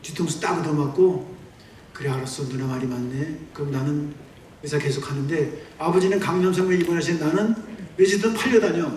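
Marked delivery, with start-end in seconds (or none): 1.9-5.7 quiet surrender. Retold or imitated. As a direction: lower and softer, giving in. Giving in to sister.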